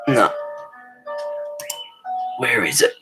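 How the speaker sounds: ordinary delivery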